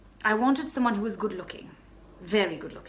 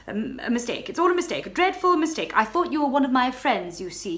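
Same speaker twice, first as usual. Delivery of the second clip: high, loud, desperate begging; frantic pleading; 5 semitones higher, 7 dB louder than usual. Acted. Baroness pleading with the stranger at the door, frantic. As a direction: beg him to leave, flustered and scared. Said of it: "I stammered, completely beside myself".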